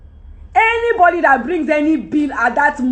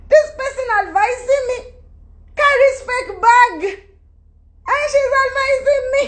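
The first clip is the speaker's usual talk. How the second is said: higher, laughing through the words; laughing disbelief; 11 semitones higher than usual. Real, not acted. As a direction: laugh at the absurdity, pitch up. Repeats the phrase in disbelief.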